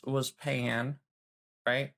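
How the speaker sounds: normal talking voice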